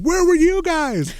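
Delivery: answering the angry hosts, mock innocent; innocent retort. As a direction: turn it back on them, playing dumb. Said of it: "And I was like".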